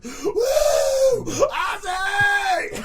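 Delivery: very high, hammed-up concert scream; fan screaming. Excited fan standing on a chair at the concert, screaming. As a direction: shriek the idol's name like a crazed fan. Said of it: "just screaming".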